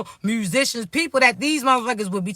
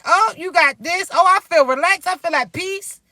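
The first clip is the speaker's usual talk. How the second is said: higher-pitched, pleased discovery; acted excitement; 6 semitones higher, 3 dB louder than usual. Newcomer discovering what the right partner offers, excited. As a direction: act out delight at a new world. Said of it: "you excited".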